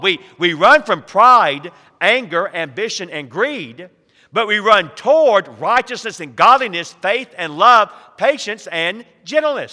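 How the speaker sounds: loud, ringing sermon summary; preacher driving the point home; full-force preaching. Real, not acted. Preaching climax, summary list.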